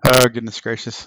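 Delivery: ordinary talk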